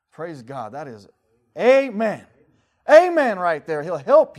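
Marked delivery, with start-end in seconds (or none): 1.6-4.4 loud, high preacher cry; shouted amen. Acted. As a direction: belt out the amen.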